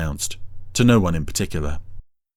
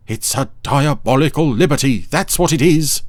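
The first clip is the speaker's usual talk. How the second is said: indignant fussy dog voice; Dougal voice; 6 semitones higher, 4 dB louder than usual. A narrator's reading. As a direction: declare it with pompous outrage. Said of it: "declared Dougal".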